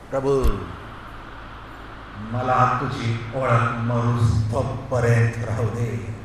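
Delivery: hushed, measured pleading; earnest prayer. Acted. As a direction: pray slowly and humbly to the Lord. Models a prayer.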